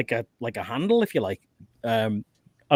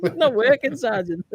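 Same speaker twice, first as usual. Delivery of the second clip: higher, whiny recruit voice on "not working"; 9 semitones higher than usual. Retold or imitated. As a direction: whine it like a clueless recruit. Recruit reporting, imitated again.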